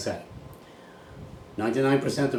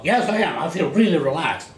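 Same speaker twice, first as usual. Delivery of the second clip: mock student protest; 9 semitones higher, 7 dB louder than usual. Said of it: "people say".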